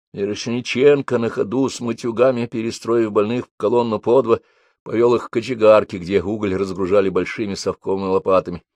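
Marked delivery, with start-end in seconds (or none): none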